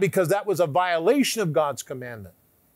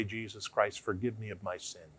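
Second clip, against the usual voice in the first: prayer; 6 semitones lower, 7 dB quieter than usual. Shown as a demonstration. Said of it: "we say".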